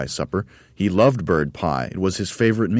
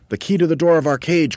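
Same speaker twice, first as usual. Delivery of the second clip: much higher, louder call; urgent cry; 12 semitones higher, 6 dB louder than usual. Acted. Mugglewump crying up to the bird on the roof. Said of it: "cried Mugglewump".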